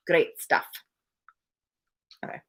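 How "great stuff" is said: "Great stuff" with a rolled R. A narrator's reading. Little man enthusing about a telly program, rolled R in "Great". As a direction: roll the R in "Great". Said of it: "couldn't resist rolling the R on that one".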